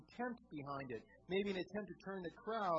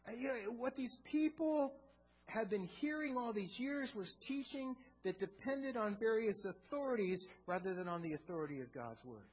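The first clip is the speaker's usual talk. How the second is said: louder, higher, driving pulpit delivery; full-force sermon voice; impassioned preaching; 4 semitones higher, 7 dB louder than usual. Acted. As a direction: preach it hard to the congregation.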